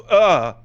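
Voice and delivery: loud, high mock groan; imitated speechless "ugh", loud; exasperated groan. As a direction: groan as if lost for words. Acts out child's speechless frustration.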